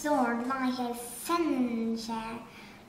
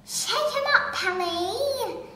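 high, raised urging; cried out; 6 semitones higher, 3 dB louder than usual. A narrator's reading. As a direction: cheer him on loudly. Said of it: "cried the giraffe".

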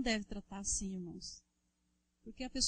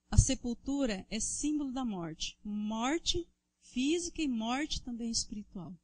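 loud, driving sermon to the congregation; fervent preaching; 6 dB louder than usual. Acted. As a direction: preach it hard, calling out to the brothers. Preaching at full force. (pregação inflamada e forte)

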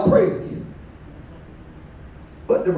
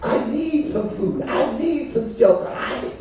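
humble soft prayer; 5 semitones lower, 4 dB quieter than usual. Pretend. Voicing humble prayer, low and soft.

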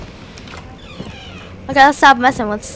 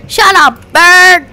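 shouted at the bird, higher and louder; mock angry yell; 5 semitones higher, 7 dB louder, slower than usual. Real, not acted. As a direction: snap at the pet bird, half-joking. Yelling at in-game bird.

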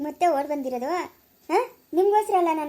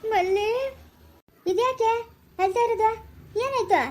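higher, worried calling out; alarmed calling; 5 semitones higher than usual. Acted. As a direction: rush in alarmed, asking what's wrong. Finds Malli crying, alarmed.

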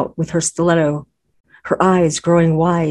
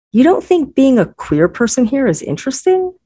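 higher, urgent protest; 7 semitones higher than usual. A narrator's reading. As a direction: push back in disbelief. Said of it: "I protested".

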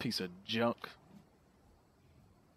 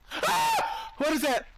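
real high shriek; 12 semitones higher, slower than usual. Real, not acted. Player startled by Titan boa, real shriek. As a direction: shriek at the sudden snake. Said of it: "I'm screaming like a little girl".

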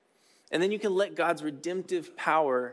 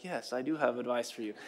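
lower, softer wise guru voice; 4 semitones lower, 5 dB quieter than usual. Acted. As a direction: play a guru gently dispensing advice. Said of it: "like a wise guru that people come to".

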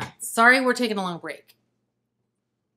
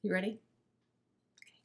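ordinary delivery

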